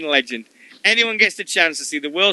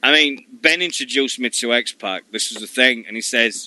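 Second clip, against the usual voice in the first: ordinary talk, nothing marked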